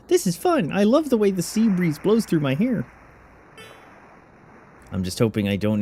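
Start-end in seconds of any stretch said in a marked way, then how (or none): 0.1-0.7 put-on much higher girly voice; squeaky high voice. Girl on the ship deck, squeaky high voice. 0.7-2.9 put-on much higher girly voice; squeaky high voice. Girl on the ship deck, squeaky high voice.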